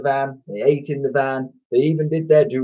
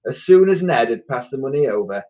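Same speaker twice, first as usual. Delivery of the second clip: ordinary delivery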